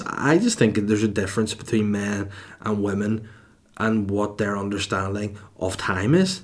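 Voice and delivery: ordinary delivery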